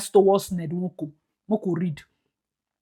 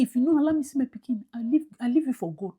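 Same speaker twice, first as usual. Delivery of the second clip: softer, quick imitated wife's plea; mock plea to husband; 9 dB quieter, faster than usual. Wife pleading with husband, softer quick mock plea. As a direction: hurry the lines like a wife's hushed plea. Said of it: "dear, say".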